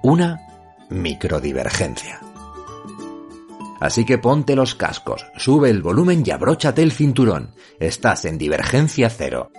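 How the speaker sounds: locutor de radio, enfático y cercano; right in your ear. Acted. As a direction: presenta el programa como un locutor. Intro de podcast con música. (voz de locutor pegada al oído)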